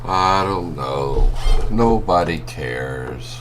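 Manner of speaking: slow, low and mopey; gloomy. Acted. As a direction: sulk it out slowly. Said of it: "gone from happy to this".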